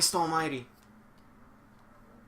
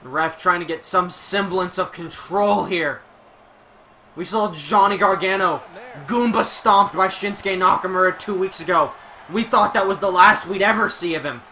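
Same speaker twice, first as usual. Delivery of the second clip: higher, louder announcer call; wrestling play-by-play; 6 semitones higher, 12 dB louder than usual. Acted. Streamer calling the match for the stream, excited play-by-play. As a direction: sell every move like a ringside announcer.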